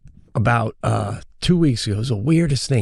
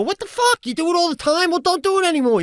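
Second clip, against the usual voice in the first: exasperated, very high-pitched mock yell; indignant retort with pitch jumping way up; retold yelling. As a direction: fire it back in acted outrage. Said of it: "I'm like, I'm yelling".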